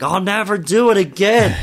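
high retold cry of regret; mock distressed wail. Retelling a relative on the kitchen floor, mock wail. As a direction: imitate a man wailing through a bad trip. Voices brother-in-law on bad trip.

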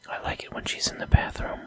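creepy low whisper. Real, not acted. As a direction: breathe it like a secret.

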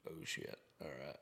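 quiet "oh shit" under breath; hushed. Retold or imitated. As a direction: mutter it, caught out. Retold reaction to cop coming.

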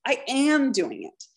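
"am" drawn out and lifted; slow line with emphasis on "am"; stress on am. Shown as a demonstration. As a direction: hit "am", then ease off. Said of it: "Put a little emphasis on the am".

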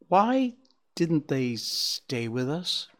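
put-on high, slow, wondering child's voice; young fawn asking mother; questioning child. A narrator's reading. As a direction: draw the question out like a puzzled little one. Child asks his mother in story.

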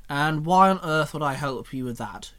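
ordinary delivery

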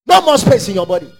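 loud, chanting deliverance prayer; shouting faith healer. Acted. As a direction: command the demon out at full force. Commands over congregation.

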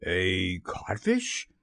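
low, slow, rising question; stunned, drawn-out query; blank echo. A narrator's reading. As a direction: repeat it dully in disbelief. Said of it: "Hook echoed blankly".

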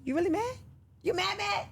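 high, teasing "you really mad?"; playful high-pitched ribbing; teasing disbelief. Retold or imitated. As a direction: poke fun at her, pitch way up in mock disbelief. Teasing co-star about being mad.

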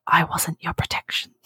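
breathy whisper in the ear. Pretend. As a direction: whisper it close and secret. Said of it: "whisper in my ear".

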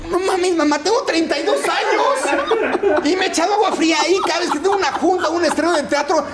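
high, laughing mock protest; outraged disbelief. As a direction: squeal it out half laughing, scandalized. Son protesting to mother, retold. (reclamo agudo entre risas, incrédulo)

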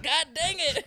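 high, loud burst of mock dismay; retold flustered outcry; embarrassed exclamation. Student caught using a fake name, loudly exasperated. Retelling embarrassment at being called out.

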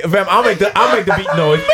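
ordinary delivery

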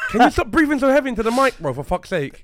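loud, high, playful scolding; mock outrage. Mock outrage at heavy breathing.

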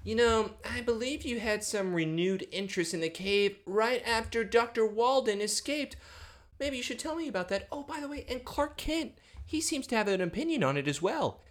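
imitating a quietly probing father; sly insinuation. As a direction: put on a high, hushed knowing voice. Acts Lionel needling Lex in retold scene.

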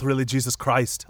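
put-on Irish-tinged Austrian action-hero accent; Arnold Schwarzenegger. Podcaster plays Terminator saying Thomas's line, mock Austrian accent. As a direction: do a mock Austrian tough guy gone Irish. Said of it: "imagine if Arnold Schwarzenegger was Irish".

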